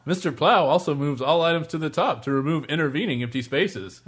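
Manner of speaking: stagey infomercial pitchman; mock product announcer; spoof ad read. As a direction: sell it like a corny commercial.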